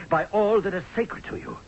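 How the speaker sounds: ordinary talk, nothing marked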